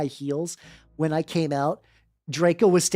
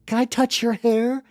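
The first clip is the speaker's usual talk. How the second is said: fan voice; 6 semitones higher than usual. Retold or imitated. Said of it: "people on the street are just like".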